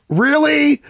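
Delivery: high-pitched sarcastic disbelief; exasperated retort, starting high; mock outrage. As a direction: throw the consolation back, incredulous. Acts out his reply to hollow consolation.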